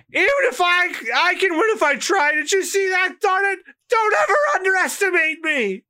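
high, tearful yelling; high sobbing cartoon yell; high crying shout. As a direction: yell through tears. Voicing Usopp, crying shout.

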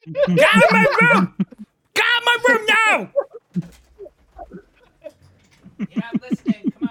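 high, loud furious shriek; screaming. Real, not acted. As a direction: shriek at them to get out. Child screaming at parent.

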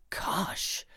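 softly gasped, mild shock; stammered shock. Acted. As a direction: let it out softly, taken aback. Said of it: "I stammered".

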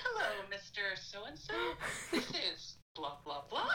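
fumbling through a message, laughing; laughing voicemail. Retold or imitated. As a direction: try to sound formal while cracking up.

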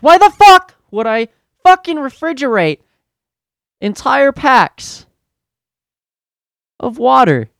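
imagined explosion at a clueless customer; furious rant. Retold or imitated. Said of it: "I didn't say it like that".

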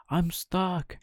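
high whispered puppet cry; a desperate little wail, breathy and high; cried out. Acted. Pinocchio crying out stuck in the cage, high breathy cry. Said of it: "cried Pinocchio".

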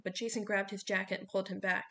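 ordinary delivery, nothing marked

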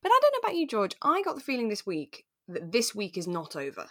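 ordinary talk, nothing marked

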